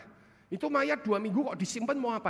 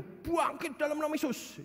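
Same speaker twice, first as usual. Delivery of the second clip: a hint of a fervent preacher, higher; imitated prayer; 8 semitones higher than usual. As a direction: lightly voice the praying pastor. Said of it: "memang doanya gitu".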